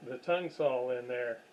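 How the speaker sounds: normal talking voice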